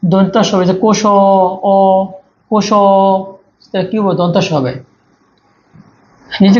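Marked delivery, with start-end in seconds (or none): 0.7-2.8 "কোষ অ" with the final অ held very long; drawn-out vowel sound. Shown as a demonstration. Teacher showing which sound follows, "কোষ অ" with a long-held vowel. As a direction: stretch the অ in "কোষ অ" slowly. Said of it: "স্বরের মতো উচ্চারণ কি হবে?".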